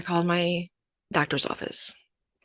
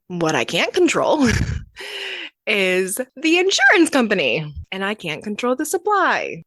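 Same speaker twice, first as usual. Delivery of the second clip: exasperated laugh breaking into louder words; laughing exasperation; 4 semitones higher, 4 dB louder than usual. Real, not acted. A diabetic mom venting about her insurance company, laughing in frustration. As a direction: laugh it off, then push louder.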